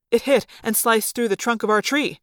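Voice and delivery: usual speaking voice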